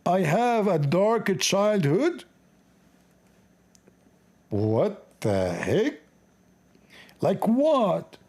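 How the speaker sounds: ordinary talk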